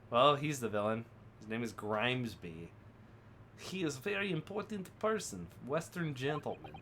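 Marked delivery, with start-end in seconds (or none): 1.4-6.7 lightly put-on Russian accent, broken English; a hint of a gruff Russian sailor guarding the cabins. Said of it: "same Russian voice".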